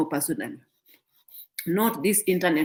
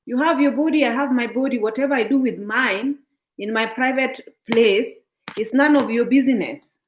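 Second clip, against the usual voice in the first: sassy retort; 5 semitones higher, 4 dB louder than usual. Retold or imitated. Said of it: "I'm like".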